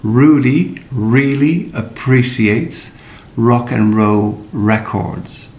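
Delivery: slow, careful model sentence; each R curled back, said slowly; slow practice sentence. Shown as a demonstration. Teacher modelling a practice sentence, slow and careful. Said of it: "let's take a practice sentence".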